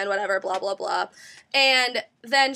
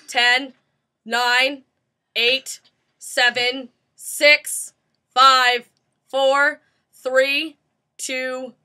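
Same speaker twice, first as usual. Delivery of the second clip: slow, measured count with gaps; countdown; 5 dB louder, much slower than usual. Retold or imitated. Presenter timing the survey, slow deliberate countdown. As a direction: call out each number separately like a timekeeper. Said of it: "she counted down so much".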